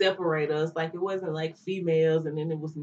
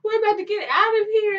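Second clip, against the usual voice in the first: much higher, fast sweet comfort; mock-cheerful girl voice, much higher and faster; reassuring girly voice; 12 semitones higher, 4 dB louder than usual. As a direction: soothe your cellmates in a high, bubbly voice. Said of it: "And I'm like".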